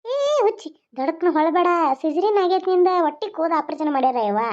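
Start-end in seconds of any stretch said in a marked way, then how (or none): 0.1-0.7 sharp call. Acted. Mother stops daughter sitting up.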